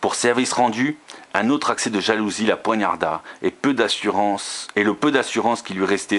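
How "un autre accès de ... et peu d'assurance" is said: ordinary delivery, nothing marked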